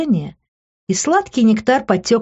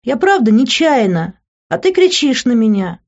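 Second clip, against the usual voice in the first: high, louder wounded whine; feigned upset; 7 semitones higher, 4 dB louder than usual. A narrator's reading. Woman faking tears at the shouting man, wounded whine. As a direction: protest as if tearfully hurt. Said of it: "продолжая притворяться".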